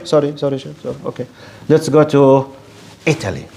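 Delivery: ordinary delivery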